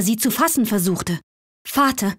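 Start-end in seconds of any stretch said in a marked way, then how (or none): 1.7-2.1 higher, light maiden's plea; sweet young voice. A narrator's reading. Fatime begging her father to take her home, sweet and higher. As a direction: plead sweetly and anxiously as the daughter. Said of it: "rief die süße Stimme".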